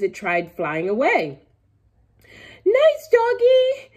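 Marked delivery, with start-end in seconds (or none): 2.6-3.9 put-on high, slow, sweet little-girl voice; soft coo. A narrator's reading. Girl soothing chicken she pretends is dog. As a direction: coax it softly like petting a pet. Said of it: "she whispered".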